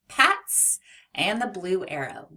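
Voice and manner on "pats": mats jumped high and loud; cheerful read-along model; bright excited reading. Shown as a demonstration. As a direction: pop the word up high. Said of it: "p-at-s".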